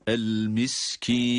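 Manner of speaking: "miskin" drawn out into a nasal, humming n; slow chanted "miskin" with ringing final n. Shown as a demonstration. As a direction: hold the end of "miskin" through the nose. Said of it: "Genizden gelen bir ses".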